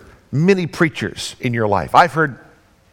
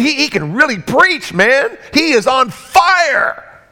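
excited crowd; 7 semitones higher, slower than usual. Acted. Townsfolk marvelling at Jesus's preaching, excited shout. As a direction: build up to a shout of praise.

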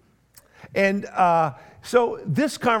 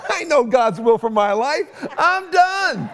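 louder and much higher, a flat-out no; stubborn outburst; 8 semitones higher, 3 dB louder than usual. Retold or imitated. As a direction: slam the door on the idea. Retold stubborn reply to wife.

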